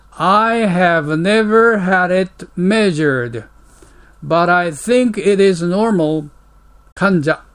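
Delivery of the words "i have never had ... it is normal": slow model reading. Shown as a demonstration. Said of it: "English model dialogue read slowly".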